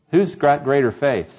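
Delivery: usual speaking voice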